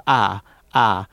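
the vowel I flattened into "ah"; Southern "ah" for I. Shown as a demonstration. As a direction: say ah in place of I. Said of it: "the vowel I, which never exists in any part of the South".

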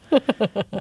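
a high burst of real laughter; laughing. Real, not acted. Guest laughing at his own fake leprechaun voice. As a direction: laugh at your own joke.

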